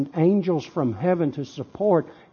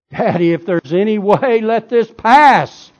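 loud pleading; 8 semitones higher, 9 dB louder than usual. Acted. Jesus begs his Father in the garden, loud pleading. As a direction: beg your father at full voice.

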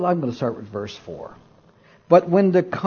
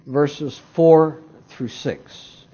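ordinary talk, nothing marked

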